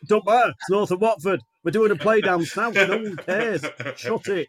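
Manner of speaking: blunt northern bloke, brushing it off; generic northern accent. Shown as a demonstration. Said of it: "a generic northern accent".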